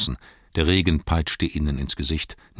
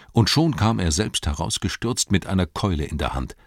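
plain reading voice

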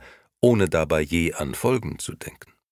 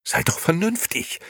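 much higher appalled plea; horrified cry; 14 semitones higher than usual. Acted. Gigi horrified as Beppo heads for the police, much higher. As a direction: cry out to stop him. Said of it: "rief Gigi entsetzt".

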